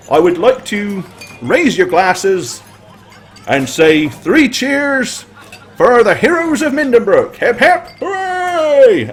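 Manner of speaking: building to a high cheer; rousing cheers. Acted. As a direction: lead the hip hip hooray. Leading three cheers.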